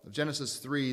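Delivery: ordinary talk, nothing marked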